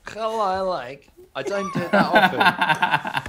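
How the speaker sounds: high, slow comic foreign voice, laughing; Borat. Acted. Friend shows off his catchphrase impression, slow and high. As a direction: draw it out as a silly impression. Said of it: "Borat impressions".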